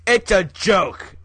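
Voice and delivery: loud, slow, exasperated. Real, not acted. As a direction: drag it out, half shouting. Insisting it was a joke.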